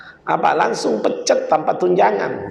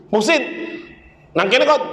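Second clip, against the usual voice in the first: calling out; 8 semitones higher than usual. Retold or imitated. Father calls his son.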